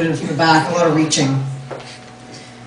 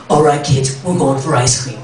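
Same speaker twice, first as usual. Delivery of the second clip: mock gruff mom; 6 semitones lower than usual. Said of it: "I'm gonna be yelling at my kids".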